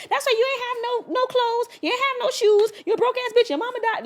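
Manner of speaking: high mock tirade; high, scornful mock of a woman cutting her man down; woman insulting her man. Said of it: "the woman would be like".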